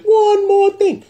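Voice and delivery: high, slow put-on uncle voice. Vlogger doing his uncle voice for the extra point, high and slow. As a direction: say it like a nosy uncle drawing it out. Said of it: "uncle voice".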